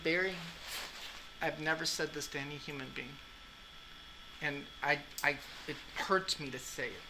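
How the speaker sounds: quiet heartfelt plea; soft somber warning. Retold or imitated. Retold serious warning to Barry.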